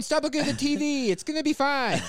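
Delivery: high reassuring boss voice; hammed-up soothing manager; mock calm manager. Voicing himself as boss.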